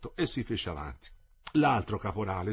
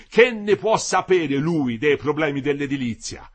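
loud, higher indignant tirade; exploding with indignation; 5 semitones higher, 8 dB louder than usual. A narrator's reading. The engineer berates the striking builders with indignation. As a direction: lash out at the workers, voice bursting. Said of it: "la sua voce esplose con tutta la carica di indignazione".

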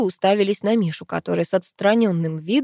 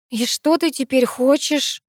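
slow, higher-pitched puzzled question; surprised; 7 semitones higher, slower than usual. A narrator's reading. Sister asks what she wants now, surprised. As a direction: ask it slowly, with raised surprise. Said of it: "удивилась Геля".

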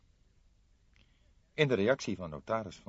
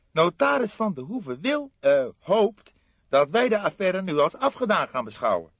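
lightly voiced aggrieved young man, higher; a young colleague, a touch higher and put out; young detective voice; 13 semitones higher, 9 dB louder than usual. Fledder protesting to his older colleague, lightly voiced aggrieved.

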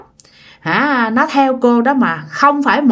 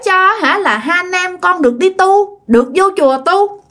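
put-on higher, eerie childlike begging; child voice; 5 semitones higher than usual. Ghost child begging its mother for offerings, eerie child voice. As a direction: beg for milk like a small ghost child. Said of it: "cái giọng con nít".

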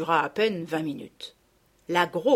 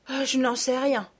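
sighing; 6 semitones higher than usual. Acted. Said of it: "répondit Sophie en soupirant".

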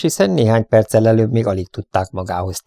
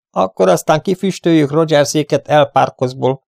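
raised, eager and glad; cheerful exclamation; 7 semitones higher, 4 dB louder than usual. A narrator's reading. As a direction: declare it in high spirits. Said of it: "kiáltott vidáman Derek".

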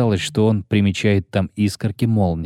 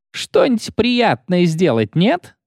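rowdy guardswoman; 8 semitones higher, slower than usual. Acted. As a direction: jeer at him like a rude soldier. Guardswoman taunts.

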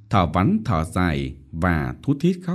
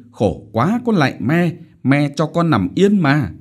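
higher, exasperated whining; whiny plea; 6 semitones higher, 4 dB louder than usual. A narrator's reading. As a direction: beg to be left alone. Daughter whines to mother. (rên rỉ nài nỉ)

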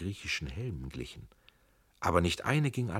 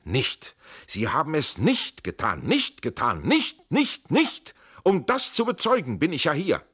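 much higher and louder, throwing all force into nicht; mighty shout; 10 semitones higher, 8 dB louder than usual. Acted. Tränk shouts down his cousin's rage with full power. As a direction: drown out the raging with one powerful word. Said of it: "rief mit mächtiger Stimme".

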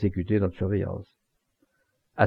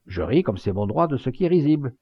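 plain reading voice

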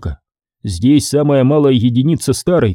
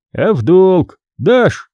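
raised, slow asking; sly question; 6 semitones higher, 3 dB louder, slower than usual. Acted. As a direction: ask slyly about a loan. Scribe asks merchant.

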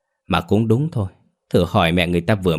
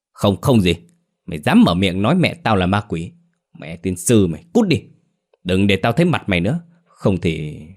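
raised-voice tirade at his wife; angry berating. Acted. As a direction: curse her out, trailing into a threat. Enraged husband berates crying wife.

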